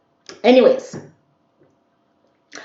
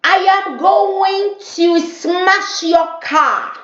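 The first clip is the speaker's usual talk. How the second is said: menacing neighbor threat; 13 semitones higher, much slower than usual. Retold or imitated. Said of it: "She said that".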